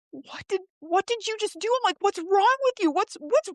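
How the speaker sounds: high, upset voice, pitched well up; retold tearful protest. Hurt woman confronting the man who pushed her, high retold tearful protest. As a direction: plead in a high voice, as if crying. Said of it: "And I'm crying".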